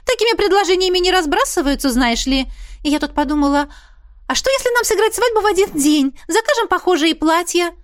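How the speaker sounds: high, excited chatty mother; mother gushing. Acted. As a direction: gush like a thrilled mother. Mother planning double wedding. (восторженная мама, высокий голос)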